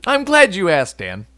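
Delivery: upbeat pitchman voice; infomercial host. Acted. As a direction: sell it like an infomercial. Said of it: "you sound like some sort of, like, infomercial guy".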